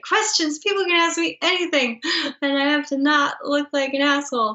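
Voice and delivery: half-laughing imitation of her own stage fright; mock panic. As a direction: replay the nerves with a jittery lift. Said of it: "And I was so terrified".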